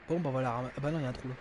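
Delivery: usual speaking voice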